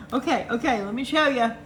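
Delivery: ordinary delivery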